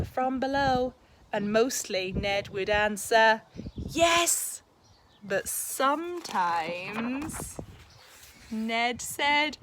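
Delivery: lively, expressive picture-book read-aloud; reading to children. A narrator's reading. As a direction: read it like a bedtime story for a class.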